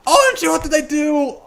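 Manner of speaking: high, startled voice; surprised squeak. Real, not acted. Gamer spotting a misplay, startled high yelp. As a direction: yelp at your own mistake.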